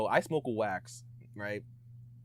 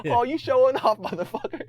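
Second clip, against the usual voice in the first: high, loud, laughing jab; laughing high-pitched mock outrage; mock offended laugh; 13 semitones higher, 8 dB louder than usual. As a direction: tease him through laughter. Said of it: "I was like".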